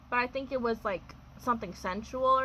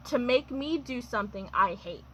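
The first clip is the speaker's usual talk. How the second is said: normal talking voice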